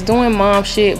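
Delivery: normal talking voice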